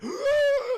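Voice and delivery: very high, loud wheezing cry; hammed-up high-pitched gasp; melting victim noise. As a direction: imitate a half-melted man's shrieking gasp. Imitates half-melted Steve.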